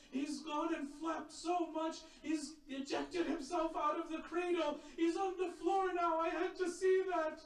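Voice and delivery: high, loud grief-stricken lament; anguished wailing cry. Acted. Zainab pleading with her brother, high anguished wail.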